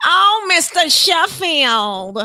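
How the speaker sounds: slow, drawn-out nasal catchphrase impression; Fran. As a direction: whine the name in a hammed-up nasal voice. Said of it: "trying to think of the goddamn guy's name".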